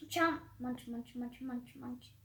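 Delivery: very soft spoken "munch"; quiet munching sounds. A narrator's reading. Narrator softly voicing chewing, very soft murmur. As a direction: mumble the chewing noises.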